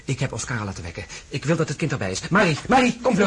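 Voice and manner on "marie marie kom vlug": loud, high call across the house. Acted. Husband calls the maid to help his wife, urgent loud call.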